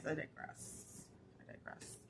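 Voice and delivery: breathy whispered aside; whispering. Acted. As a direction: drop to a whisper. Repeats digress, then whispers it.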